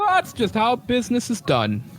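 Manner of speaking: high, put-on merchant's voice; shopkeeper character voice. Game master as shopkeeper justifies the price, high put-on voice. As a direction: defend the deal in a high character voice. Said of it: "trying to find this guy's voice".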